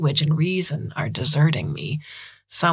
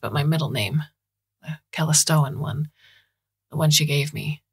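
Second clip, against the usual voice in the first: ordinary delivery, nothing marked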